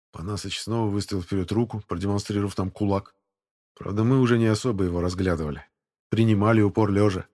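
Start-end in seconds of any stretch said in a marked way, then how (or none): none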